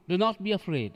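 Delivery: ordinary talk, nothing marked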